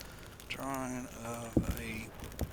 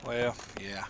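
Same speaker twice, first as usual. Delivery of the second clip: ordinary talk, nothing marked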